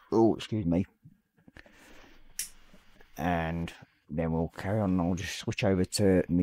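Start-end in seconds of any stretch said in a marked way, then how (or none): none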